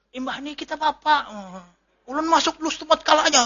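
straining over music. Retold or imitated. Talking to wife by loudspeaker.

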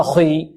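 خي with a too-heavy, backed خ; over-thickened khaa. Shown as a demonstration. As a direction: thicken خي wrongly, darkening its vowel. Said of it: "أعطيناها مرتبة أكثر من مرتبتها". (خي بمبالغة في تفخيم الخاء)

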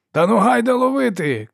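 high, loud rallying yell; man shouting. A narrator's reading. Brother urges the chase after Ferro, shouting. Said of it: "крикнув чоловік".

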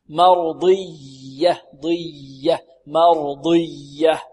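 drawn-out correct model of "مرضية"; pressed flowing ya. Shown as a demonstration. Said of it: "ننطق الياء مشددة فيها ضغط".

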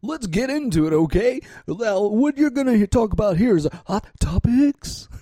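high, bright announcer voice; radio host voice. Pretend. Playing a radio host intro.

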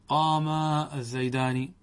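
قام with a long final aa, then separate الزيدان; rejected dual alef. Shown as a demonstration. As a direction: draw out qaamaa before saying الزيدان. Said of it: "هذا مرفوض". (مدّ ألف التثنية في «قام» وافصلها عن «الزيدان»)

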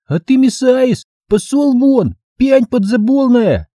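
put-on high, slow childish lisp; squeaky little kid bossing a grown-up; six-year-old boy. Little boy ordering a man out of the bushes, high childish lisp. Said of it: "чумазый шестилетний карапуз".

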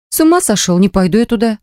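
shocked, pushing back; alarmed refusal. Acted.

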